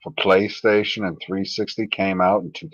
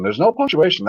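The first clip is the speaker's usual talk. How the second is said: usual speaking voice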